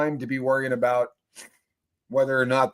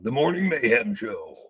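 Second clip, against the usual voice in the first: mock announcer voice; 5 semitones lower than usual. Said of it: "one of those deep voices going".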